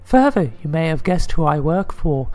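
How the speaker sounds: high-pitched put-on lady's voice; high falsetto woman. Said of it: "I get to do the lady voice".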